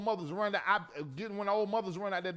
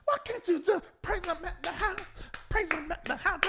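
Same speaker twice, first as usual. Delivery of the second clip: high, rhythmic church call; preacher-style pleading; 8 semitones higher than usual. Acted. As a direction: exhort the congregation in a high voice. Same preacher-style plea, over music.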